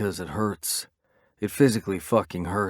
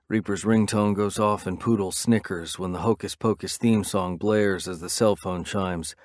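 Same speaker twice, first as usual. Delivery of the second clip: usual speaking voice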